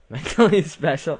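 high, loud repeat through laughter; laughing at own wording. Real, not acted. Player amused by own botched question, laughing repeat. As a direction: crack up while repeating your own words. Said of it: "That didn't come out right".

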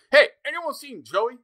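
a cop faking a Texan twang, badly; bad Texas accent. Undercover cop asking locals about Joey, in a bad Texas accent. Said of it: "the worst Texas accents ever".

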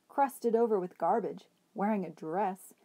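ordinary delivery

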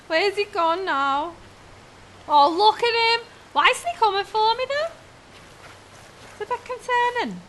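ordinary delivery

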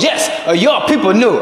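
put-on Japanese-accented girlfriend comeback. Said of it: "I do the accent because she's from Japan".

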